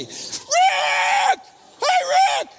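high, loud yelling at the window; imitated shout. Drunk woman calls to a friend on the tarmac, shrieking. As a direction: call out to Rick outside.

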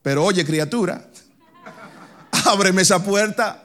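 put-on a laughing, playful Dominican-accented plea to open the door; Dominican Pedro. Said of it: "si fuese dominicano".

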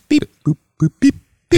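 mock phone dialing beeps; beep-boop it like a keypad; robot saying binary. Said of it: "Do you need dialing noises?".

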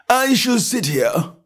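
high and slow, drawn out; slow footman. A narrator's reading. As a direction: settle in without hurry. Footman remarks.

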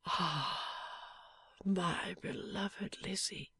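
slow, breathless whisper of a wounded man; dying man's whisper. A narrator's reading. Wounded husband whispering to his wife, faint and slow. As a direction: barely get the words out, fading.

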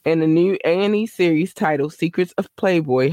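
normal talking voice

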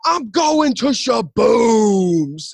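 high, loud, drawn-out holler; imitated parting yell. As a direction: holler it long, as if storming off. Character shouts while storming off.

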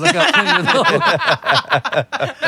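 high, slow laughing punchline; laughing joke. Real, not acted. Joking about fighting, laughing high. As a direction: crack up on the punchline.

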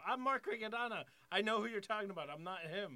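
high, loud identity plea; insistent protest. Retold or imitated. Comic showing woman his driver's license, loud insistent protest. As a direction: insist loudly, pitched well up.

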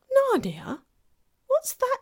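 put-on high, surprised girl's question; a young girl puzzled by a strange name. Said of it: "said Lucy".

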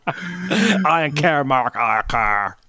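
put-on slow, dragged-out bored Valley Girl drawl; wife brushing off her husband, slow and bored. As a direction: stretch it out, utterly uninterested. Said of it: "I can read her mind".

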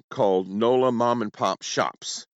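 ordinary delivery

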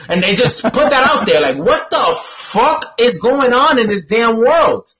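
normal talking voice

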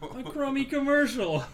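loud, high, drawn-out complaint; mock outrage. Host quotes the let-down kid from the film, mock outrage. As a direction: quote the kid's disgust with hammed-up volume. Quoting the kid's outraged line.

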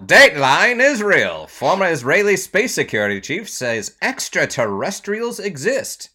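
loud, punchy headline read; news anchor voice. Acted. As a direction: announce it like breaking news. Announces news story.

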